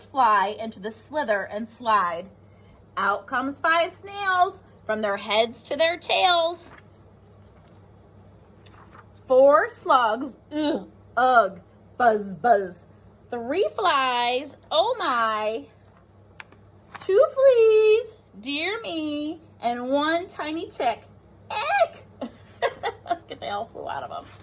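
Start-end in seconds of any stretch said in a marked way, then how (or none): 3.0-21.0 put-on lively read-aloud for young children; teacher reading a rhyming book to the class; storybook reading. A narrator's reading. 21.5-22.0 much higher, laughing ick of disgust; teacher hamming disgust for the kids; squealed ick. Acted. Tick flies out, disgust.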